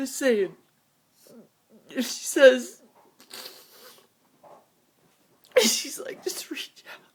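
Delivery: choking out the words while weeping; crying. Real, not acted. Telling viewers about a movie scene, crying. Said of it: "I'm sorry about the crying".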